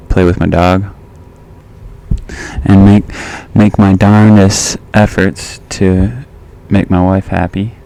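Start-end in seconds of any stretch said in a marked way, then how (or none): none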